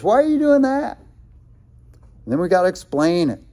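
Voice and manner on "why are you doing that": much higher, mocking taunt; high-pitched scoffing jab; mocking question. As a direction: make fun of the believer. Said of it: "make fun of us".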